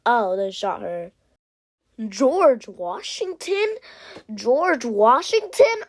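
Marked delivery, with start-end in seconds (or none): 2.1-5.9 slow, high, loud rising call; amazed question. Real, not acted. Seeing George Washington still in the game, amazed. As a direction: drag the name out in astonishment. Spotting a surprising character.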